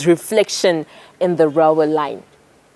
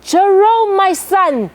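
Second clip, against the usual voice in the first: father shouting; 11 semitones higher, slower than usual. Acted. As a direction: shout to stop your son. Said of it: "And so he shouted".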